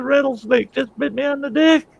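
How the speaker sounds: very high hammed-up howl; agonized wail. Bitten Lone Ranger explains to Tonto, very high agonized wail. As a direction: howl it out in acted pain. Bitten man repeats in agony.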